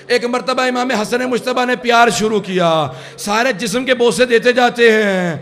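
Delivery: loud, climbing mourning recitation, drawn out; impassioned majlis recitation. Acted. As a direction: pour grief into the telling. Majlis recitation at full force. (مجلس کی بلند، پرسوز قرات)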